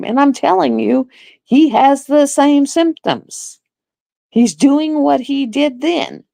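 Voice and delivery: high, heated insistence. Retold or imitated. Nurse arguing with the doctor about her dad, high and heated. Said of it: "I was so livid".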